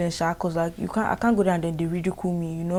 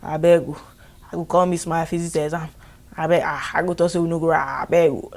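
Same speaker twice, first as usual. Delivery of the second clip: mock begging in Nigerian Pidgin, louder; pleading pidgin; 4 dB louder than usual. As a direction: plead like a scared student in Pidgin. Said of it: "everybody was like".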